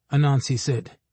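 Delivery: plain reading voice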